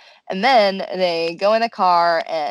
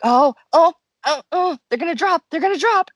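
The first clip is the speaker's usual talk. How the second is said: higher, rising excitement building to a peak; mock excited panel; 9 semitones higher, 4 dB louder than usual. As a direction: hype each drop like eager fans. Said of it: "they're like".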